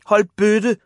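high, loud yell; screamed protest. A narrator's reading. Bullied Ivan to jeering boys, screamed protest. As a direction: yell at them to stop. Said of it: "skreg Ivan".

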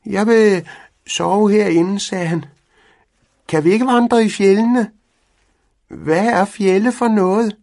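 high, loud, eager cat voice; a keen little cat begging to sleep in the tent. A narrator's reading. Cat pleading with Pedersen to go hiking, high and eager.

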